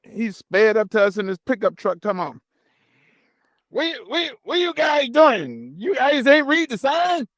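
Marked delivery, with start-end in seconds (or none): 3.7-7.3 high, shouted mock guard voice; imitating angry guard. Narrator mimics the gate guard scolding the truckers, high and shouting. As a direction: hammer him with a mock yell.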